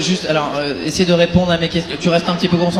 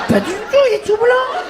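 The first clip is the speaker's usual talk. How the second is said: mimicking her voice; slower than usual. Mimics the woman's earlier answer.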